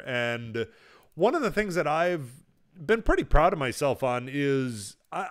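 ordinary talk, nothing marked

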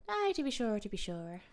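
hammed-up stock Irish brogue; mock Irish accent. Host teases co-host mid-chat, mock Irish accent. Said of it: "do the whole podcast in Irish".

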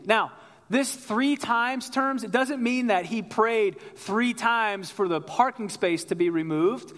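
ordinary delivery

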